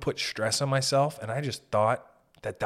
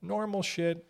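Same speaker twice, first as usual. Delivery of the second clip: mock bland email voice; 9 semitones higher, much slower than usual.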